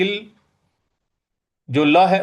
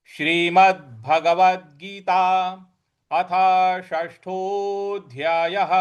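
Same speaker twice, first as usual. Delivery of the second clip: slow, stately chapter opening; slow Sanskrit recitation; scripture recitation; much slower than usual. Acted. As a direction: announce the text solemnly.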